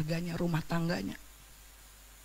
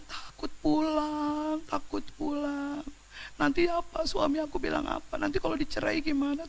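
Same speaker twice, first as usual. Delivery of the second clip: scared sister; 6 semitones higher, 6 dB quieter, slower than usual. Retold or imitated. Sister voiced, scared of husband.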